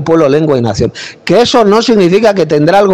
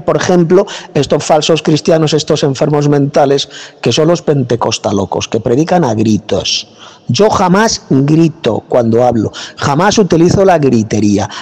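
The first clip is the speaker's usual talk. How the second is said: ordinary talk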